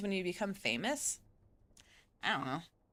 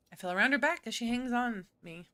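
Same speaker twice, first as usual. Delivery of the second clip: ordinary talk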